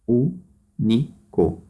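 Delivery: "Único" slowly split into ú, ni, co; teacher counting out the three syllables of "Único". Shown as a demonstration. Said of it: "três sílabas".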